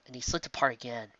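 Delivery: usual speaking voice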